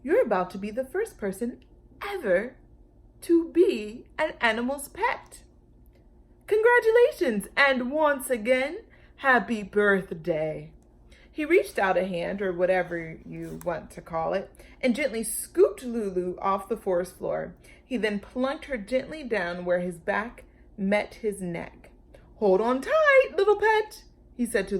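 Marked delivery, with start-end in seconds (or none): 0.0-10.7 gentle, courteous giant; polite. A narrator's reading. Brontosaurus politely congratulating Lulu. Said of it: "spoke more politely than Lulu". 22.4-24.0 high, loud, fond giant voice; affectionate dinosaur. Acted. Brontosaurus lifting Lulu onto his neck, fond.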